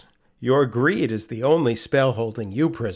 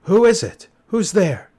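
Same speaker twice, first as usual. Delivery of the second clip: higher, louder alarmed question; startled; 8 semitones higher, 7 dB louder than usual. Acted. Herschel to an unseen voice, startled. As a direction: jolt awake afraid.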